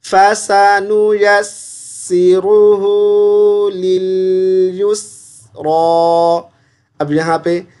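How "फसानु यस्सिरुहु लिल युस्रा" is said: slow melodic tilawat chant; Quran recitation. Shown as a demonstration. Teacher recites the verse for the student, slow tilawat. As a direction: recite it slowly, drawing out each vowel.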